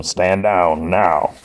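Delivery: slow, drawn-out order; lightly voiced firm command; Cable. Cable ordering Gambit to back off, slow command. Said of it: "Cable tells him".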